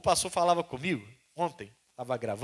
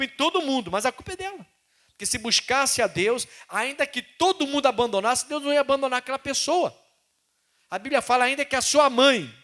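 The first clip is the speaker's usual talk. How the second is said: loud, raised preaching; full-force preaching; 9 semitones higher, 8 dB louder than usual. Acted. Preacher to the congregation, loud full-force preaching. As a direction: drive the point home.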